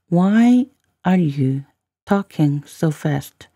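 very slow teaching delivery with pauses; slow, in chunks. Shown as a demonstration. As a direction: spell out the phrase slowly, chunk by chunk. Teacher repeats line in slow chunks.

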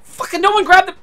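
sudden high, loud outburst at teammates; yelling in real frustration at the screen; frustrated shout. Real, not acted. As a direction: blow up at the team.

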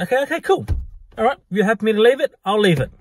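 breezy placating to drop it; mock-agreeable backing off.

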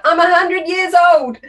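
Kyle Newbin shout. Acted. Author jokingly answering a kid's question about age, shouted. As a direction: shout it out to the whole hall. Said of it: "should have done what Kyle Newbin does and shout".

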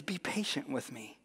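hushed, subdued plea; understated begging. A narrator's reading. Servant on his knees before the master, softly begging. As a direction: drop very soft for the servant's plea. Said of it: "he begged".

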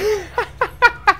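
high giddy burst of laughter; delighted laugh. Real, not acted. Laugh after great kill. (risa eufórica)

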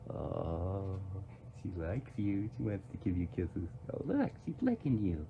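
ordinary talk, nothing marked

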